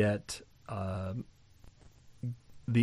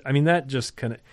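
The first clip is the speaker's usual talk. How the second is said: ordinary talk, nothing marked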